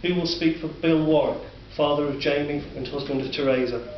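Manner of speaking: ordinary delivery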